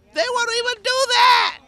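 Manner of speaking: high, loud outraged cry; angry shout. Real, not acted. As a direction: shout it out at the landlord. Tenant repeats complaint, shouting now.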